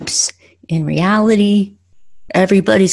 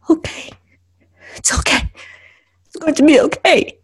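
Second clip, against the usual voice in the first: breathy, then high and fragile; anxious self-soothing; 8 semitones higher than usual. Acted. Driver tells herself it will be okay, anxious self-soothing. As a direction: try to reassure yourself through panic.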